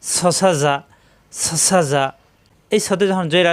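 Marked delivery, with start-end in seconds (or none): 0.0-2.0 "সসা যা" run together as short syllables; quick pronunciation. Shown as a demonstration. Teacher drills short vowels for students, quick joined syllables. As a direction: rattle off "সসা যা" without holding vowels. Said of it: "দ্রুত উচ্চারণ করতে হবে".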